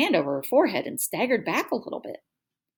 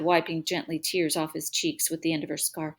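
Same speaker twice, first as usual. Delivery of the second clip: ordinary delivery, nothing marked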